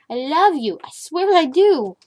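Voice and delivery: loud, insistent reassurance; mock-exasperated love. Real, not acted. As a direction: swear affection to the cat. Reassuring the cat.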